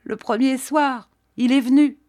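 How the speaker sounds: high, broken-up admission; faltering stammer. A narrator's reading. Collapsed maid answering her mistress, stammering.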